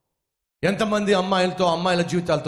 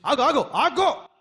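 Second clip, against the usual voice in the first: calling stop; 10 semitones higher, slower than usual. Acted. Lame man stops the fight.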